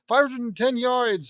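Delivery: high, loud measurer's call; announced distance. Acted. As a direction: proclaim the score to the crowd.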